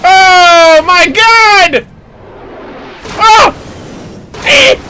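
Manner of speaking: long drawn-out astonished cry; real shock. Real, not acted. Streamer watching the boss transform, loud stretched shock.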